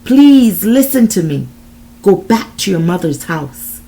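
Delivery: loud, urgent pleading; desperate plea. A narrator's reading. John warning Desiree about the man, desperate plea. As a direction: plead with her to listen. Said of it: "in a desperate voice".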